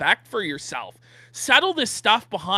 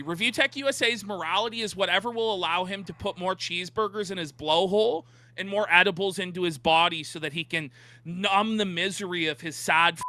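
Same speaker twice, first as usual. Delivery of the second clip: ordinary talk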